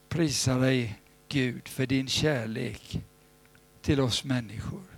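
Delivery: hushed earnest prayer. Acted. Leads the prayer.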